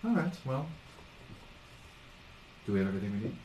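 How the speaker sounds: soft, secretive murmur; hushed conspirator. Acted. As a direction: confer quietly so nobody overhears. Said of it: "they are very much whispering".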